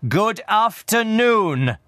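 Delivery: high, slow, emphatic send-off; exasperated dismissal. A narrator's reading. Scrooge shows his nephew the door, exasperated. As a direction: drag out the dismissal forcefully.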